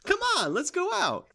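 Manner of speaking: high, eager mom pulling her son out; excited mom voice. Acted. Pushy mom acted in improv.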